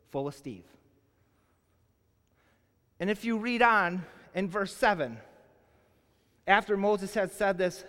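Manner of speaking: ordinary talk, nothing marked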